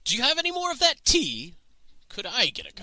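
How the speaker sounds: put-on eager old man. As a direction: pipe up hopefully, pitched right up.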